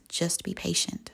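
very soft, hushed reply; calm them down almost under your breath; quiet reassurance. Acted. Jesus turns to the impatient listener, quiet reassurance. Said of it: "he quietly turns to you".

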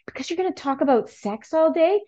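lightly voiced curious daughter, pitched high; high, rising question as if from a child; a hint of innocent little-girl curiosity; imitated child's question.

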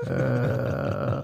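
low breathless zombie groan. Pretend. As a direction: groan like the undead rising. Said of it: "And I sound like a zombie as well".

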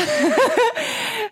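bright burst of laughter; real delighted laugh; high laugh. Real, not acted. Laughing at friend's new voice.

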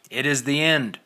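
crying out. A narrator's reading. Old man crying out his warning to the riders. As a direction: cry it out like a prophet. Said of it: "cried the man".

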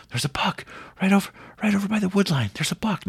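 high, fast, breathy whisper; urgent whisper. Retold or imitated. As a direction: whisper fast so the deer won't hear. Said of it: "He goes".

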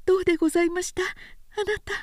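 put-on young woman asking her man how it went; anxious young wife. A narrator's reading.

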